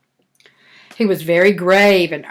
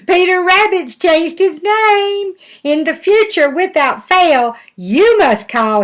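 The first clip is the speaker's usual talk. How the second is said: mocking announcement; 9 semitones higher, 3 dB louder than usual. Breezes deliver mocking message.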